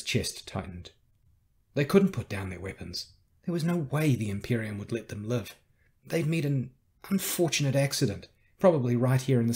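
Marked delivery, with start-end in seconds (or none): none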